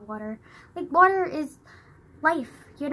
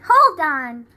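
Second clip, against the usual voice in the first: louder, sudden call to someone off-mic; 3 semitones higher, 10 dB louder than usual. Real, not acted. Vlogger interrupted by grandma mid-video, calling out. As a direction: cut in with a loud "hold on".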